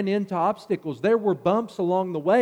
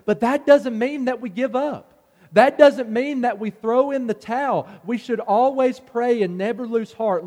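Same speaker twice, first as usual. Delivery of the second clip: higher and louder with each line, preaching full out; rising preaching; 5 semitones higher, 3 dB louder than usual. Acted. Preacher rallying the congregation, building to full force. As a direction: build the sermon to a peak.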